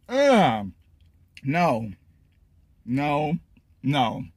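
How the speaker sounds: reacting to spicy bite; talking while chewing. Real, not acted. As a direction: mouth partly full. Seen 1: Lips pressed, grimacing, mouth working between words; no chicken seen.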